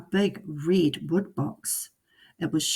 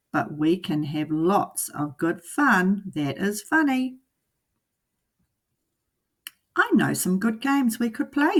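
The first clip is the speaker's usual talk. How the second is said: higher, lively cat in the hat voice; 5 semitones higher than usual. Acted. The cat coaxing the bored children, lively and high. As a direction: play the cat as a cheerful trickster.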